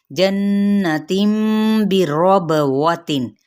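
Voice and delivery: drawn out, chanted recitation of the word; slow Quran recitation. Shown as a demonstration. Teacher reciting a Quran word for students, slow and chanted. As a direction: recite it slowly and melodically, stretching the vowels.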